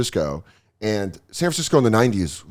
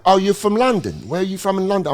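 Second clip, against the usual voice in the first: higher, put-on British accent; fake British accent; 7 semitones higher than usual. Hostess asks the real Londoner where she's from, in a fake British accent. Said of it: "didn't have a real British accent".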